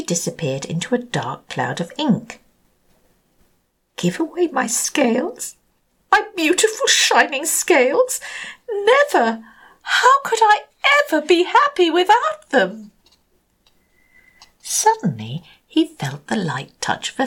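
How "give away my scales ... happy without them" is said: high, rising indignant refusal; refuse, scandalized and proud; indignant Rainbow Fish. Acted.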